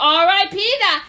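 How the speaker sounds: loud hyped shout. Pretend. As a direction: yell it out with a laugh. Hyped declaration.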